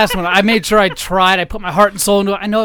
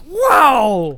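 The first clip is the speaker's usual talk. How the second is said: amazed wow; 7 semitones higher, 3 dB louder than usual. Retold or imitated. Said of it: "I was like".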